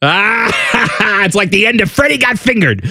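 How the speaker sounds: high mock cackle; hammed-up gloating laugh; pointing and laughing. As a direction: laugh mockingly at whoever is watching. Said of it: "it's just me pointing and laughing".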